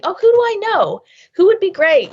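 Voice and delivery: eager wondering. Acted. Coworker thinking of who to introduce, eagerly wondering. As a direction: act out a sudden helpful brainwave.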